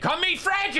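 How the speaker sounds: very high imitated scream; mock yelling. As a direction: yell it like a raving stranger. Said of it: "he started yelling at them".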